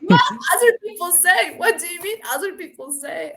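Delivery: indignant double take; incredulous. Real, not acted. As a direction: echo his words in disbelief. Repeats his words in disbelief.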